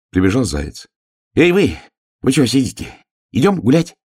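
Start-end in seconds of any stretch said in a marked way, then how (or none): 1.3-2.9 high, loud, lively hail; excited calling out. Acted. Hare runs up, calls friends. 3.3-4.0 high, loud rising call; eager invitation. Acted. Hare invites friends out.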